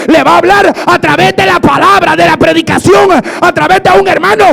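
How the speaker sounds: building from loud insistence to high shouting; preaching at full force. Real, not acted. Preacher warns that God speaks in judgment, full-force shouted preaching. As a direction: hammer each phrase out at full volume.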